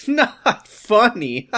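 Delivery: high, loud, drawn out through laughter; hammed-up giggly scolding; laughing protest. A narrator's reading. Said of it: "Amy's anger turned into laughter".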